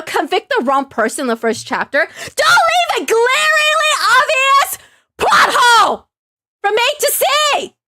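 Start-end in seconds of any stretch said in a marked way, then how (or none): 2.4-7.7 very high, drawn-out outraged yell; upset outburst. Real, not acted. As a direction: shriek it slowly, word by word, outraged. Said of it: "I'm so upset at this game".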